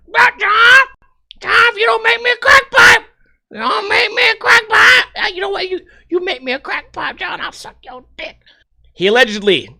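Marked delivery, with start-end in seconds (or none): 0.1-5.0 high, loud screeching woman demanding a crack pipe; shrill crackhead woman. Acted. Girlfriend yelling at boyfriend for a pipe, high screeching. Voicing Tammy pleading. 5.2-8.4 high, softer wheedling woman; sly bargaining girlfriend. Acted. Girlfriend bargaining with John, high and hushed. Voicing Tammy bargaining softly.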